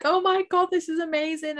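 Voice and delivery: retold overjoyed wonder at the sky; awed exclamation. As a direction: gasp it out in delighted awe. Retold awe seeing sign in clouds.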